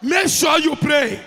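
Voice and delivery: loud, slow, chanted prayer to the Lord; shouted prayer. Real, not acted. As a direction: cry out each plea slowly and forcefully.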